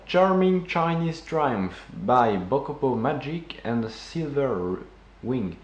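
slow, heavy French-English accent; bad English accent. Real, not acted. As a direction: read the English title slowly with a clumsy English accent. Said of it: "mon accent anglais est, est monstrueux". (accent anglais maladroit, lent et appuyé)

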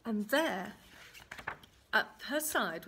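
plain reading voice

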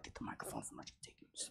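hushed whisper to a neighbour; whispered aside. Retold or imitated. Church member whispering to take the microphone from her. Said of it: "And somebody's like".

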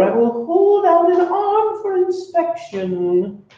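smooth, high, slow silky purr; a very high, silky, unhurried Ralph; silky monster voice. Acted. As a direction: charm them like a polite salesman monster. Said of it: "silky voice".

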